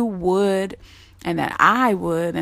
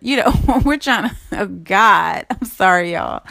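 real laughter breaking into the words; cracking up. Real, not acted. Apologising to listeners mid-talk, cracking up. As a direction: giggle through it, apologising as you go. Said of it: "I'm laughing because I'm so free".

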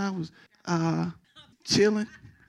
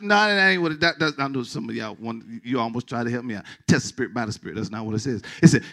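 normal talking voice

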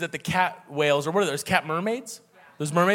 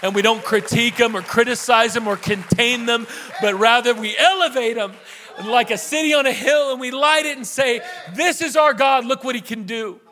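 higher and louder, building to a shouted climax; preaching at full force; 5 semitones higher, 5 dB louder than usual. Acted. Pastor rallying the church to lift up its youth, preaching at full force. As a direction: preach it, lifting the room on every phrase.

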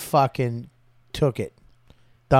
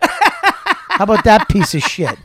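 louder, higher, drawn-out outrage; hammed-up comic tirade; acted indignant rant; 6 semitones higher, 6 dB louder than usual. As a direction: tear into the boss with mock outrage. Acted rant about the cigar bar owner.

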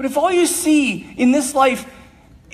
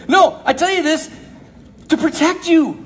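ordinary talk

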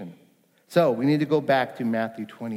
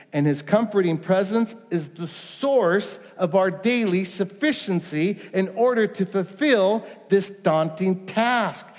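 impassioned preaching; 6 semitones higher than usual. Acted. Pastor stirring the congregation about the daunting task, fervent. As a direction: rise to a fervent pitch.

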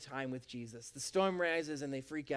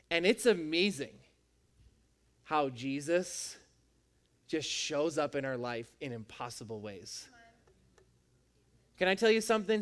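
ordinary delivery

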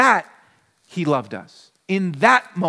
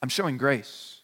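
gentle Jesus; 7 semitones lower, 7 dB quieter than usual. Retold or imitated. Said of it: "And he says".

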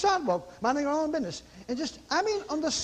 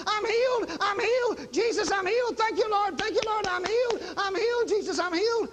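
high-pitched ecstatic repeated cries; mock scream of rapture, pitched high; imitated screaming; 8 semitones higher than usual. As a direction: imitate a woman crying out that she's healed. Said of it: "she's standing there screaming".

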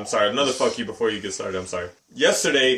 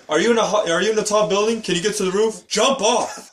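movie scene imitation; 8 semitones higher than usual. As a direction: act out her eager questions, pitched up. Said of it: "It's like that scene in forty Over".

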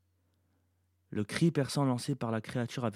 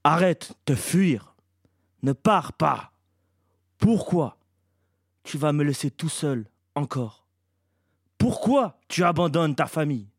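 loud, raised, anguished creature's demand; abnormal creature voice; 3 semitones higher, 7 dB louder, slower than usual. A narrator's reading. Winged creature reproaches Iblis for leaving his family, crying out unnaturally. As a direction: cry out as an abandoned creature, pleading and accusing. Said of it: "sa voix n'avait rien de normal".

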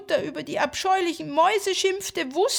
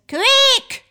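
shrill squeak; 13 semitones higher, 12 dB louder than usual. Acted. Mouse king squeak.

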